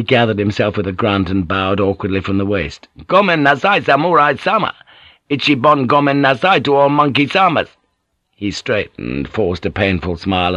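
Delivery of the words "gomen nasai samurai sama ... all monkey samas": put-on high, raised drunken call. A narrator's reading. Drunk bowing awkwardly to the samurai, calling out. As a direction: call out an exaggerated apology. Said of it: "he called out again".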